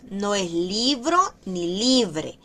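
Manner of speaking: ordinary delivery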